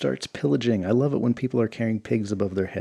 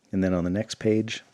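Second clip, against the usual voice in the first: plain reading voice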